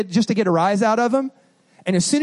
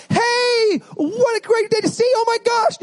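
over-cheerful greeting; 12 semitones higher than usual. Acted. Preacher acts a tactless greeting to someone crying, over-cheerful. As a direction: gush far too brightly, pitched way up.

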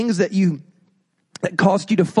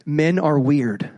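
ordinary talk

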